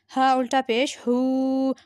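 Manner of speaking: normal talking voice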